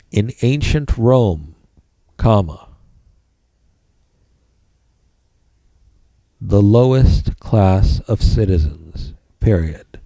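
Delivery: dictating. Shown as a demonstration. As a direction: spell out the notes for students. Dictating notes to the class.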